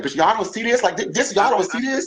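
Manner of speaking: high, incredulous plea to everyone watching; retelling how she begged people to see it; exasperated disbelief. Retold or imitated. Retold plea to followers who ignored the insult, exasperated and pitched high.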